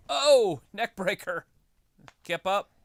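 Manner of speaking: sudden excited shout. Real, not acted. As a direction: call the move out loud. Excited calling of moves.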